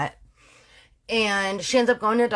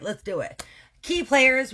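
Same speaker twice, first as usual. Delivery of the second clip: usual speaking voice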